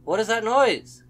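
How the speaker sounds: high, loud rattled question; alarmed shout. Real, not acted. Player hears a strange noise in game, alarmed shout. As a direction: blurt it out in alarm.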